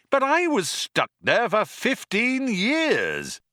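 high, slow wail of indignation; indignant protest. A narrator's reading. Ford complains to Zaphod about being stranded, indignantly. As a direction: protest it high, stretching the words.

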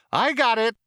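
high, loud machine reply; keen, over-cheery computer voice; eager computer. A narrator's reading. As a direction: answer brightly and instantly as the computer.

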